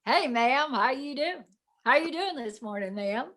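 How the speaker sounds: lightly imitated Southern accent. Castmate greeting her in the makeup room, lightly put-on Southern accent. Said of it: "talking to me in a Southern accent".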